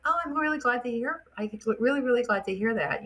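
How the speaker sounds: telling the husband how glad she is; delighted. Retold or imitated. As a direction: gush with pleasure, voice up high.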